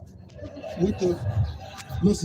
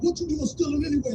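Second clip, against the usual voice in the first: dad going off; 8 semitones higher than usual. Retold or imitated. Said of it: "And he going off".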